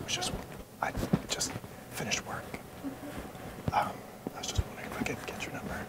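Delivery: hushed, fast whisper. Real, not acted. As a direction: whisper it close and quick. Whispering to ask for number.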